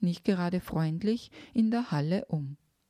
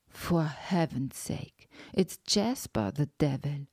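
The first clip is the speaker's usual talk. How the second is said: English, shocked; 3 semitones lower than usual. A narrator's reading. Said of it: "hatte ihn der Schock seiner Muttersprache beraubt". (Englisch, fassungslos und langsam)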